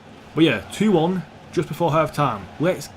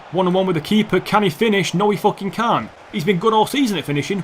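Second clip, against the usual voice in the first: raised, urgent play-by-play turning to exasperation; agitated commentary; 5 semitones higher, 4 dB louder than usual. Real, not acted. Gamer watching his striker miss one-on-one, agitated and high. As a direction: build up the chance, then fume at it.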